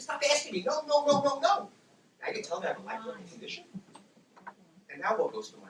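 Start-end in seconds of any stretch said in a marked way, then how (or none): 0.0-1.7 loud, high volley of retold refusals; fed-up shouting, acted out; exasperated protest. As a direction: fire off the noes at full voice. Retold exasperated plea to stop asking.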